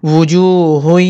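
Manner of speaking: ওয়ুজুহুন with the ending held through the nose; nasal ghunna. Shown as a demonstration. Teacher shows idgham, ওয়ুজুহুন with a long nasal ending. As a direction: hum out the last syllable of ওয়ুজুহুন nasally. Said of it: "নাকে নাকে উচ্চারণ করতে হবে".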